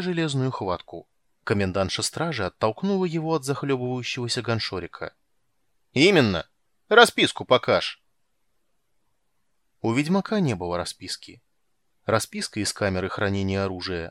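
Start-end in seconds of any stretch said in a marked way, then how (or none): 5.9-8.0 gruff loud commandant; loud demanding. A narrator's reading. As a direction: bark the order out.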